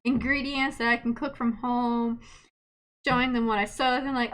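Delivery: normal talking voice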